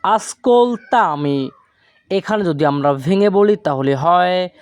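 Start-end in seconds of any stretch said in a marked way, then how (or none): 0.0-1.5 very slow, syllable by syllable; slow model word. Shown as a demonstration. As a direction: spell it out with your voice. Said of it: "এই বাক্যের Italian হবে". (ধীরে ধীরে উচ্চারণ)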